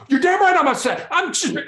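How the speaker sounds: loud, high furious outburst; angry yell. Retold or imitated. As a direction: blow up at the negotiator. Acted ranting suspect.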